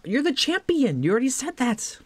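high-pitched, incredulous outburst; high, exasperated comeback; mock protest. Acted protest to game character.

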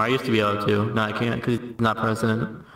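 ordinary talk

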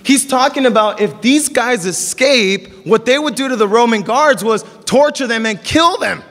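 ordinary talk

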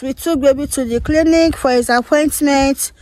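ordinary delivery

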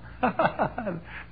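a short burst of real laughter; laughing. Real, not acted. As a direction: laugh at yourself briefly. Laughs at his own story.

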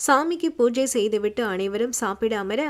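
plain reading voice

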